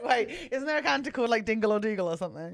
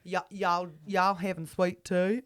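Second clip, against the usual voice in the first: hammed-up Texan twang; Texan accent. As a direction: play a Texan with folksy hospitality.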